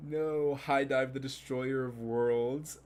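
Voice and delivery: loud, drawn-out mock doom; playful over-the-top proclamation; mock-dramatic lament. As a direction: cry it out slowly, like an epic villain's title. Mock-grand outcry at streaming service.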